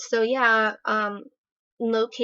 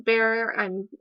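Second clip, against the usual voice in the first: normal talking voice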